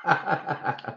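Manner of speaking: soft, high giggling; real laughter. Real, not acted. Laughing at own botched foreign title, soft high giggles. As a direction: giggle helplessly, softly.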